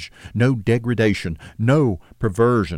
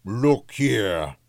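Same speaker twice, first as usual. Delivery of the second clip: mournful ghost voice, lightly done; a touch of sorrow in the command; sorrowful spirit; 3 semitones higher than usual. A narrator's reading. The spirit shows Scrooge the children, sorrowful. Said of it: "was the spirit's sorrowful reply".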